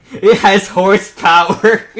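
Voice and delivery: loud, slow laughing delivery; howling at the horse pun; laughing hard. Real, not acted. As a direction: squeeze the words out between bursts of laughter. Cracking up at the pun.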